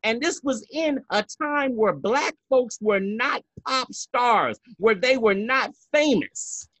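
high-pitched, indignant diva rant; Fernando. Acted. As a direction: scold emphatically, driving each point home. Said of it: "I'm gonna be Fernando for a second".

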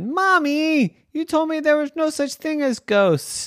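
put-on high-pitched child whine to mom; protesting child. A narrator's reading. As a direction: complain to mommy in a high kid voice. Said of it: "And she said".

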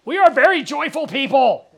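loud, high put-on voice; mock joyfulness. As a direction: belt it out in hammed-up fake joy. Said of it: "so bad".

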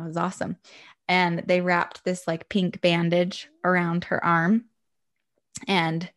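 usual speaking voice

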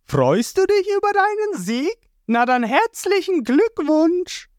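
very high sneering cartoon villain; Mister Free's voice. A narrator's reading. Mister Free taunts the giant, high sneering villain voice. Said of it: "wird von Mister Free angesprochen".

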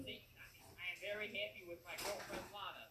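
ordinary talk, nothing marked

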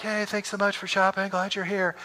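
high, put-on polite shop clerk; imitated over-polite cashier; passive-aggressive shop greeting. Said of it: "It was very passive, very aggressive".

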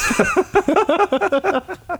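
very high giggle; real high giggle after the bit; laugh. Real, not acted. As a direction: burst out giggling.

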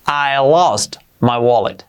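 "I lost my wallet" slow, stress on lost; slow clear "I lost my wallet"; slow model sentence. Shown as a demonstration. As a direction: model it slowly for learners. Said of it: "¿cómo sería?".

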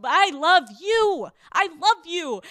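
mock gushing declaration. Mocking her old calculated self, gushing loudly at a rich guy. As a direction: oversell the love, fake and eager. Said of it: "It was like".

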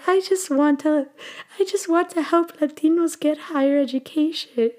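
high, tearful plaintive voice; plaintive, choked-up retelling; retold crying. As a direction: re-enact a weepy confession, pitched high. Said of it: "while I was crying".

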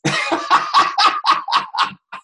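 real burst of loud laughter; hearty laugh. Real, not acted. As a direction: crack up at your own impression. Cracks up after impression.

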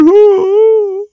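squeaky high-pitched cackle; high mock laugh. Mocking a comedian's laugh, squeaky high cackle. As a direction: burst into a shrill mimic laugh.

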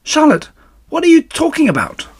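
high, loud startled pig; alarmed disbelief. Acted. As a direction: cry out in dismayed surprise. Wilbur alarmed at Charlotte's news.

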